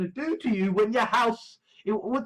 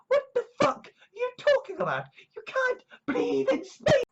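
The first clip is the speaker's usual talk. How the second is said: much higher exasperated disbelief; incredulous outburst. Real, not acted. Ranting at a silly space movie, high and exasperated. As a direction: squeal it in disbelief.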